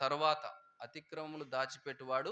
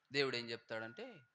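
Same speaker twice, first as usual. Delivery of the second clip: ordinary talk, nothing marked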